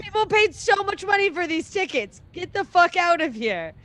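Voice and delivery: loud, high-pitched indignant telling-off; acted outrage. Imagined audience to Shakespeare heckler, loud indignant outburst.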